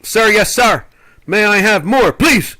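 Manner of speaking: shouted high like a recruit to the Captain; hammed-up military yell, pitch rising to a squeal; drill-sergeant shout. Shouted military-style plea.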